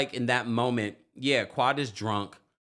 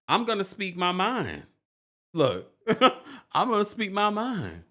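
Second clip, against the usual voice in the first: lightly voiced, laughing mock Sweet Tea; 7 semitones higher than usual. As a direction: quote her defiance through a laugh. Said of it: "she's just like".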